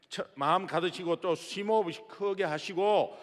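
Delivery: ordinary talk, nothing marked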